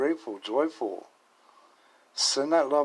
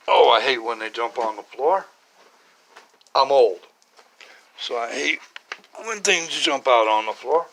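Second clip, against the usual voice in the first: straining while bending down; grunting as she reaches; effort grunt; 7 dB louder than usual. Real, not acted. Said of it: "Excuse me for the grunting, groaning, and moaning".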